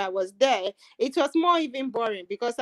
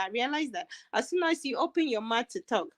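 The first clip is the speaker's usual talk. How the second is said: ordinary talk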